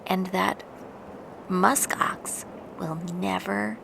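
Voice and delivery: soft secret whisper. A narrator's reading. Mother lemming hushing family beside the musk ox. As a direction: keep it barely audible. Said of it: "she whispered".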